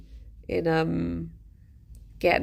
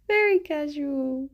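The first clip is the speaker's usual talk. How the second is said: "Very casual" said higher with amusement; amused remark; 7 semitones higher than usual. Real, not acted. Teasing the dog about acting innocent, amused and higher.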